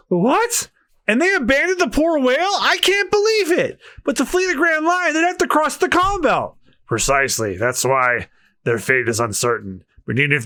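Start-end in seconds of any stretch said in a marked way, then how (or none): 0.1-6.6 put-on much higher, earnest pleading girl; high young woman voice. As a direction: plead as a heartfelt young heroine. Same woman voice, shocked.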